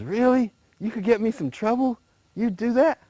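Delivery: a naive alligator, pitched high and eager; lightly voiced, wide-eyed alligator asking about trouble. Alligator asking the rabbit what trouble is, high and eager.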